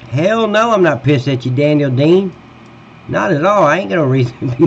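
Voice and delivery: high and loud, brushing it off; loud reassurance. Real, not acted. Telling Daniel Dean he's not mad, loud and high. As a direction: insist you're not mad, loudly.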